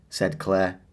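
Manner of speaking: usual speaking voice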